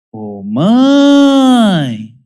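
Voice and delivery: high, loud whiny "Ô, mãe!" tattling; a child running to accuse a sibling, whiny call; accusing child's call. Retold or imitated. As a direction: call "Ô, mãe!" like a kid about to tell on a sibling. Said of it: "diz com essa entonação".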